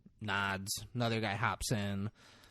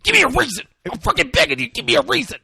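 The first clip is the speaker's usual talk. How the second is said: frenzied high screaming, fast and very loud; out-of-control threat; 12 semitones higher, 13 dB louder, much faster than usual. Retold or imitated. Cop with a gun to Roger's head, unhinged mock screaming. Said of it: "this team is out of control".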